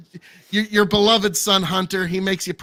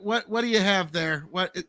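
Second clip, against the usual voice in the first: ordinary talk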